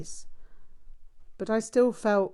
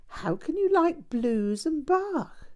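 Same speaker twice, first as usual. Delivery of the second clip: much higher, slow, disbelieving question; incredulous; 11 semitones higher, 3 dB louder than usual. A narrator's reading. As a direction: stretch it out in disbelief, stress on you. Girl questions narrator incredulously.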